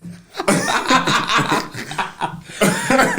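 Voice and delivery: long, high fit of laughter. Real, not acted. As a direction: crack up laughing. Laughing at the story.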